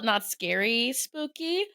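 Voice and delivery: usual speaking voice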